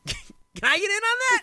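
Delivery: squeaky high-pitched mock voice; high cartoonish voice, eager; silly high voice. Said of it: "he'd, uh, be like".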